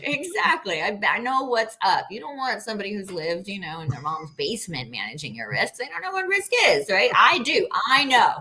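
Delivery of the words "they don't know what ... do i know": mock brag. Real, not acted. Mock bragging about taking risks.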